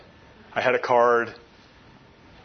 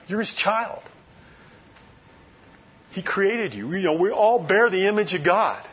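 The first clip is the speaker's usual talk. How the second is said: raised, ringing preacher's voice, pitched higher; fervent sermon voice, higher and stronger; preaching; 7 semitones higher than usual. Acted. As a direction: lift it up and preach to the congregation. Preacher rises into exhortation.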